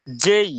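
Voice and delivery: "জেই" held long with an i-glide; drawn-out diphthong in "জেই"; correct J. Shown as a demonstration. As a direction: model "জেই" for learners. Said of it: "কিন্তু এটা হবে জেই".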